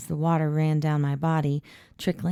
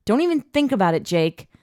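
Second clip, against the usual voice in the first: loud, high stern warning; 6 semitones higher, 6 dB louder than usual. A narrator's reading. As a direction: warn him sternly. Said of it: "as stern as possible".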